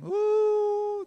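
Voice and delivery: high mock wail of grief; mourning wail. Shown as a demonstration. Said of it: "Can I hear a bit of mourning?".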